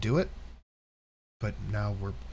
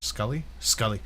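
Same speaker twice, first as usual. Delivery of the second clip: normal talking voice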